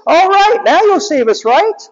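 high loud hopeful robot; high squeaky robot henchman; Scratch's voice. Retold or imitated. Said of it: "Scratch goes".

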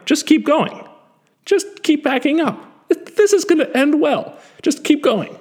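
high, carefree inner voice egging him on; mock inner voice. Speaker mocking the reckless voice in his head while backing up, high and carefree. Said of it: "said the same thing in my head".

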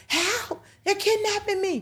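high mock screaming for help; retold child scream. Son screaming to strangers outside the store, high mock shriek. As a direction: shriek it like a kid in a tantrum. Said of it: "bucking and screaming".

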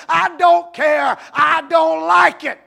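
slow, high, shouted refusal; childish tantrum. Retold or imitated. Grown man refusing like a two-year-old, shouting. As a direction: stamp it out like a stubborn toddler.

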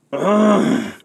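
high exasperated ugh; annoyed groan. A narrator's reading. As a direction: groan in frustration. Castor groans.

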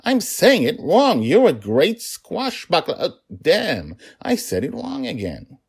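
high, loud, comic exasperation; flustered father botching the word; mock dismay at his own slip; frustrated with himself. A narrator's reading. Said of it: "He seemed frustrated with himself".

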